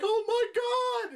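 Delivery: hammed-up terror; mock panic. Reviewer voicing the girl as water fills the box, mock panic.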